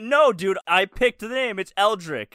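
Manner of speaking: exasperated insistence. Retold or imitated. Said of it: "I'm like".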